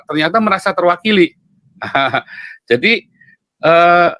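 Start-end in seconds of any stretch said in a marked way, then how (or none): 1.8-2.5 short soft chuckle; brief real chuckle to oneself. Real, not acted. Speaker amused that people feel represented, short chuckle.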